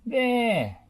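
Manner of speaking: high, loud cry; cried out. A narrator's reading. Scoop crying out to Rolly, high and loud. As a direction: point and cry it out. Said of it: "cried Scoop".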